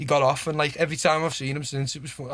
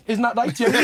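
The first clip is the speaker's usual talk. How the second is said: laughing imitation; 8 semitones higher, 4 dB louder than usual. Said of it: "he'd say a joke and he'd go".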